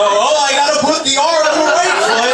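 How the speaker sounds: high, loud, exaggerated self-instruction; mock deliberation. Comic mocking himself over labeled socks, hammed-up deliberation. Mocks consciously thinking about socks.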